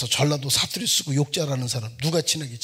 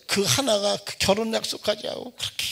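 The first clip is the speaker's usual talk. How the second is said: normal talking voice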